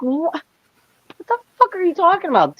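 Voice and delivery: high-pitched retold disbelief; incredulous comeback. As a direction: act out a baffled, indignant reply. Retelling her baffled comeback to him.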